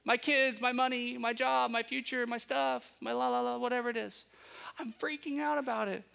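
high, frantic pleading to God; imitating an anxious prayer. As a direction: blurt out your worries in a rush. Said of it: "and saying".

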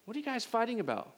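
a hint of a concerned bystander breaking up a fight; calling out. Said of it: "And he says".

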